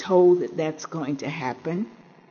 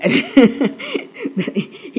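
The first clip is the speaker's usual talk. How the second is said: a short high burst of laughter; real laugh; 8 semitones higher, 4 dB louder than usual. Real, not acted. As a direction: laugh at your own joke. Laughs at own paraphrase.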